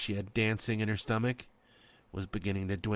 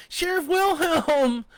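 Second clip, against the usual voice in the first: high, loud, drawn-out shout; shouting his name; 9 dB louder, slower than usual. A narrator's reading. Trapped woman shouting up to the sheriff. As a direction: call his name as loud as you can. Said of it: "she shouted".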